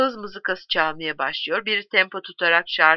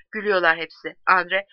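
plain reading voice

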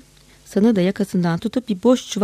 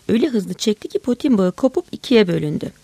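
plain reading voice

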